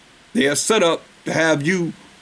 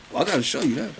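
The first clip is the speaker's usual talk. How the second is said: usual speaking voice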